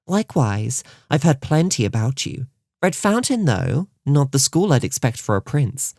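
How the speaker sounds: put-on lightly affected high-class accent; put-on posh accent. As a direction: put on a prim royal accent. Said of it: "the accent Stella had adopted".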